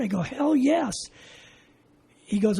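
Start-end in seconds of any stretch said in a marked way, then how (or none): 0.2-0.9 eager yes. Retold or imitated. Eager answer to lifeguard offer.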